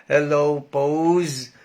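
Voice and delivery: usual speaking voice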